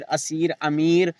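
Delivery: असीर, अमीर with the ई held long and slow. Shown as a demonstration. Teacher reviewing the stretched ye slowly. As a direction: stretch the ई clearly. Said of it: "ये सब खींच कर पढ़ी जा रही है".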